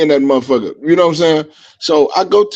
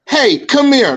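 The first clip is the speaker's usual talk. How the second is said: higher-pitched mimicry of a curt summons; retold call-over; 7 semitones higher, slower than usual. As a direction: mimic a stranger calling someone over. Repeats the stranger's summons.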